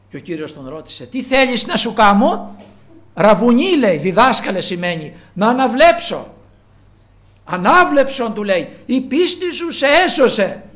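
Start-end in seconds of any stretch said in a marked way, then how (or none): 1.1-2.6 high, loud asking of Christ; loud question. Acted. Christ asks the blind man. 3.1-10.7 high, loud voicing of both figures; loud acted dialogue.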